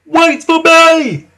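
high, loud little engine calling out; whistling call. A narrator's reading. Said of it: "whistled Whiff".

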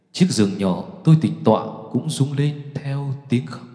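ordinary delivery

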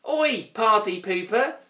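high-pitched, snapping troll; snappy little troll. A narrator's reading. Grouch tells off the party pooper, high and snappy. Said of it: "snapped the Grouch".

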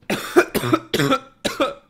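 a loud, high hacking cough; harsh cough. Real, not acted. Sick streamer coughing mid-game. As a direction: cough hard, genuinely sick.

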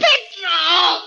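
very high, crying shout of despair; anguished cry. Acted. Gorgo cries out as Petrov drags him off, anguished. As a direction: scream his name in despair.

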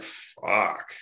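breathy whispered swear under the breath; hushed dismayed fuck; whispered curse. Retold or imitated. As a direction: mutter it, stunned. Reacting to hearing five thousand a day.

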